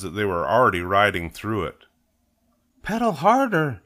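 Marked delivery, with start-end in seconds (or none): 2.8-3.8 put-on very high, urgent child's call; urgent cry. A narrator's reading. Cub urges friend on through wet cement, urgent. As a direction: cry out to hurry. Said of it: "cried Sister".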